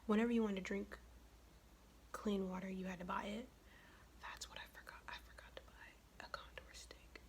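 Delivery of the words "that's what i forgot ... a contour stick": hushed, voiceless mutter to oneself; much softer, whispered self-reproach; whispered aside. Real, not acted. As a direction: whisper it under your breath. Aside on a forgotten item.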